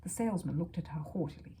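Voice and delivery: ordinary delivery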